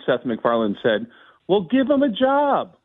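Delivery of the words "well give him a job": imitating the boss. Guest voicing the producer hiring him over the phone, pitched high. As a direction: put on a much higher voice for the producer. Retells Seth MacFarlane on the phone.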